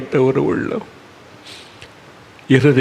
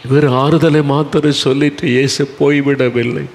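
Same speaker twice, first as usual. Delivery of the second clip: ordinary talk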